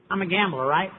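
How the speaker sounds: ordinary talk, nothing marked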